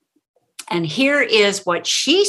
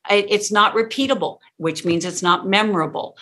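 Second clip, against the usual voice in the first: ordinary talk, nothing marked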